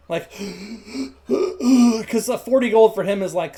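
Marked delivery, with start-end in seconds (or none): 0.3-1.2 winded, stunned gasping for air; breathless gasps. Acted. Boy falls back at forty gold, breathless gasping. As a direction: gasp as if the wind left you. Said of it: "out of breath".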